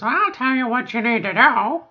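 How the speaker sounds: high, loud, preachy mock lecture; bossy know-it-all. Mocking older women lecturing newcomers in a bossy voice. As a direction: lay down the law like you know best.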